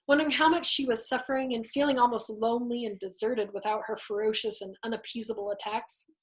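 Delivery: usual speaking voice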